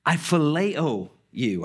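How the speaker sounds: slow, deliberate "phileo"; slow earnest confession. Retold or imitated. Peter answering Jesus, slow and humble. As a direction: place each word with care. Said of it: "And Peter says".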